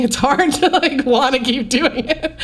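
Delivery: laughing while talking. Real, not acted. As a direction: laugh through the admission. Laughs while admitting childhood quitting.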